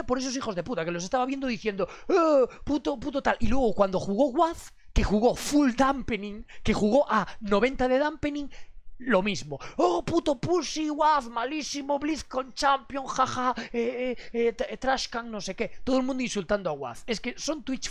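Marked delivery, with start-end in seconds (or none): 2.1-3.4 high, loud jeering imitation of chat trolls; mock chat troll. Streamer mocking chat trolls, jeering voice. 9.8-15.7 high, slow drawn-out mocking voice; mock troll jeer. Streamer imitating chat flaming Waz, slow mocking jeer.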